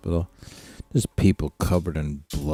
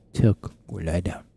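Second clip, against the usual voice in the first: usual speaking voice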